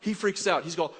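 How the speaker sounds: ordinary delivery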